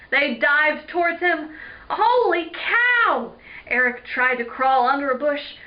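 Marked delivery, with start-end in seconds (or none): 1.9-3.3 high, loud, drawn-out exclamation; alarmed exclamation. Acted. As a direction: stretch out a startled cry. Eric sees lizards diving at him.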